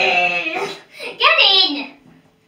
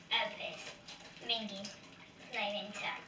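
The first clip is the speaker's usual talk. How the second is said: disgusted murmur; 10 semitones lower, 21 dB quieter than usual. Real, not acted. Disgusted aside about toe.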